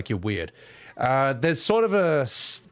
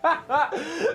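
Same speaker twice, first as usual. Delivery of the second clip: high laugh. Real, not acted. Laughs at own rant.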